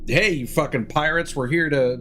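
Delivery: ordinary delivery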